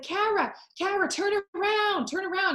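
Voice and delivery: pitched high, calling across the pool. Retold or imitated. Dad calling his daughter from the stands, high call. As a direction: shout up high to get her attention.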